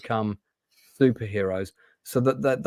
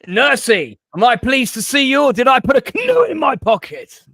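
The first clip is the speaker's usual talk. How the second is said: booming, high-pitched comic bravado; loud, high Blackadder lothario impression; Lord Flashheart; 13 semitones higher, 8 dB louder than usual. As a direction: shout it out in hammed-up swaggering style. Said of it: "Lord Flashheart in Blackadder".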